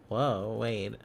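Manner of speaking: normal talking voice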